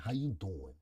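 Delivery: put-on low, soft Italian Brooklyn accent; playful Italian Brooklyn accent, voice dropped low. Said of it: "Say it in your Italian Brooklyn accent".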